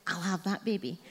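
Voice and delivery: low, quiet deadpan acceptance; imitating mum, softly matter-of-fact; mum's decisive reply. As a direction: drop it low and soft, as retold decision. Acts out mum's instant decision.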